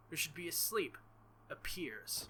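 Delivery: normal talking voice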